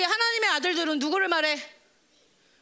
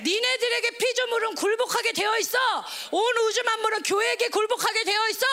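high-pitched preaching declaration; raised, insistent pulpit voice; forceful proclamation; 6 semitones higher than usual. Acted. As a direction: proclaim it as God's binding promise. Proclaiming God's promise with authority.

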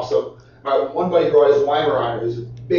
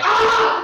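dog's yelp. Retold or imitated. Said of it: "he was just like".

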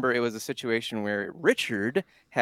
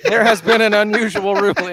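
loud, high mock ref announcement; mock official announcement; 9 semitones higher, 10 dB louder than usual.

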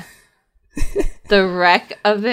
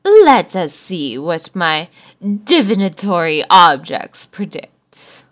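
loud, grand mystic voice; fortune teller; 7 dB louder than usual. Acted. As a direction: intone like a seer. Fortune teller reads cards.

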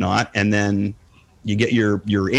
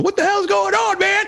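confused viewers. Retold or imitated. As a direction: play the lost viewer throwing up his hands. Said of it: "everybody's going".

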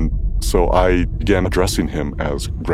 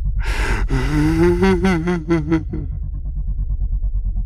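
eerie high laugh; 12 semitones higher than usual. Retold or imitated. Spirit departs, strange laugh.

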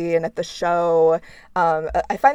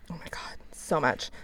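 whisper; 10 dB quieter than usual. Real, not acted. Agreeing in a hushed aside.

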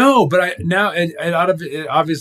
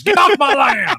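shouted; 4 dB louder than usual. Pretend. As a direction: yell it high and loud. Mock angry landowner shouting.

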